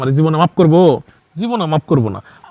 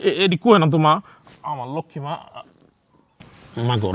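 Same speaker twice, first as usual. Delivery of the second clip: normal talking voice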